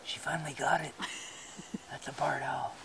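hushed whisper at night. Real, not acted. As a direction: whisper so the owl isn't scared. Whispering so the owl stays.

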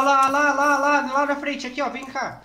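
high, loud urgent pointing out; excited alarm. Real, not acted. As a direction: call out the danger urgently. Spots monster, alerts friends.

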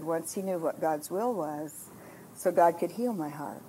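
soft, low thanksgiving; hushed, grateful prayer; tender prayer. Retold or imitated. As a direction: pray it quietly and sincerely.

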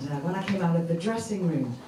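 normal talking voice

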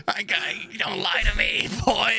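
put-on old grandma voice. Grandma scolding him for lying, in an old-lady voice. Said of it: "She's like".

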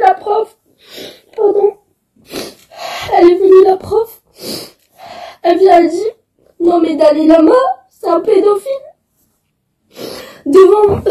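tearful, voice wavering between sobs; crying. Real, not acted. Girl telling viewers about racism at school, crying. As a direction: speak through real tears.

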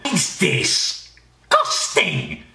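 normal talking voice

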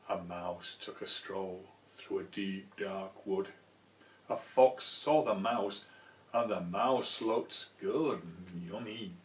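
cosy picture book reading; storybook narration. A narrator's reading. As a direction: read it like a bedtime story.

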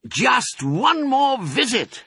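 high, slow, showy announcement; excited cry. A narrator's reading. Fox announces the next raid, crying out. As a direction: announce it with relish, stretching each word. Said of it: "cried Mister Fox".

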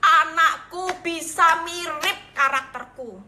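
high, loud victory cry; jubilant heavenly cheer. Retold or imitated. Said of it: "bersorak-sorai".

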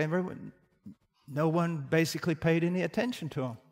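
normal talking voice

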